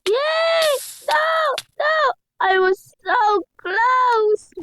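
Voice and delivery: high loud cry of near-miss; frustrated yelling. Real, not acted. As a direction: yell at losing so close. Just misses the jump.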